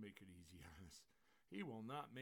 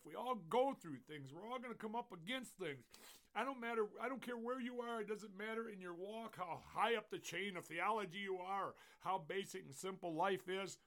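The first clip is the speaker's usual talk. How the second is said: higher, insistent sermon delivery; forceful preaching; 8 semitones higher, 8 dB louder than usual. Acted. Pastor tells congregation everyone faces trials, preaching forcefully. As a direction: press it home like a preacher.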